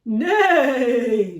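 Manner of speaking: loud laughing neigh; whinny like a horse. Acted. Horse answering Duck with a loud neigh. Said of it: "we have to use the voices".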